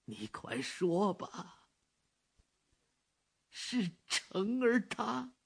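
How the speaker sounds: high, slow, fearful questioning; a dread-filled old man urging; anxious old man. Acted. Father presses for news. (焦虑的老人)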